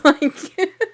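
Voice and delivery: ordinary talk, nothing marked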